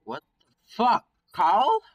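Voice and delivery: loud, high-pitched shocked outburst; startled shout. Acted. Frightened friend to the sheeted Carl, startled shout. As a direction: yelp it in alarm, voice leaping up. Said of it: "I shout, whisper to him".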